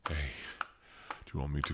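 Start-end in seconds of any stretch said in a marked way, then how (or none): none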